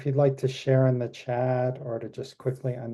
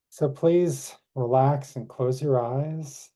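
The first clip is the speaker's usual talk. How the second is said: guided meditation. Acted. Leads closing meditation.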